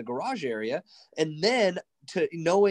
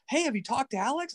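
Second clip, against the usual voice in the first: a hint of higher, eager friend; lightly voiced Peter; 11 semitones higher than usual. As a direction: ask it brightly as an eager old friend. Peter voiced in retold story.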